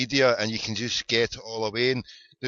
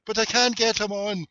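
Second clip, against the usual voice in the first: put-on high-pitched little boy protest; imitated wee Irish boy; 8 semitones higher than usual. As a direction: mimic a small boy complaining. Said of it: "wee Irish boy there, he's giving it".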